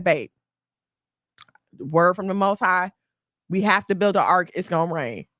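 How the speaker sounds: low, grave husband voice; earnest man delivering a prophecy; solemn husband. Acted. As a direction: announce it low, with pauses, like a revelation. Husband relays word from God.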